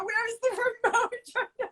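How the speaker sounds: high, slow mock panic; frantic hunt for the remote. Mom searching for the remote during the sex shop scene, mock panic. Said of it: "I'm trying, you know".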